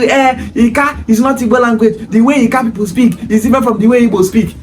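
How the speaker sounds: lightly voiced objector's protest; mimicking objectors. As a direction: mimic their excuse with a leading "eh". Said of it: "they will start telling you".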